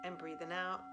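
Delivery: calm meditation guide, long pauses between cues; guided meditation. Real, not acted. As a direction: gently lead them through a body scan, leaving room to breathe. Leads a body scan.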